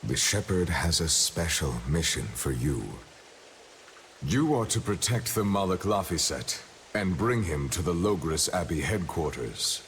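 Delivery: very low, monotone order; deep, unvarying voice of authority; solemn command voice. Acted. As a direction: hand down a mission in a deep, even monotone. Remembered order heard in the scene.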